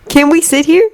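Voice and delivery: put-on high little boy's question; shy first-year asking; young boy voice. A narrator's reading. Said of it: "a relatively high male voice".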